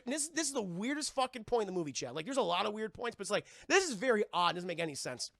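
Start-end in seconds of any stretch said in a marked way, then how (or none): none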